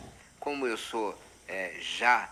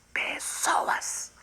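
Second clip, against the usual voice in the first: drawn-out knight's call; 10 semitones higher, much slower than usual. Acted. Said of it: "eu tô encarnado em Dom Quixote".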